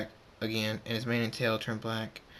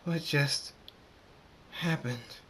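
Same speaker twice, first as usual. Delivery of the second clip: heavy panting, then higher broken phrase; out of breath; 4 semitones higher, slower than usual. Acted. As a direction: gasp the words out one by one, exhausted. Collapses panting after transforming.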